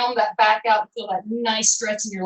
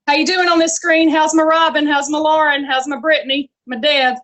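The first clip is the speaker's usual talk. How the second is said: loud, raised roll-call cheer; 4 semitones higher, 8 dB louder than usual. Real, not acted. Instructor checking on class members on screen, calling out. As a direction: call out to each one across the room.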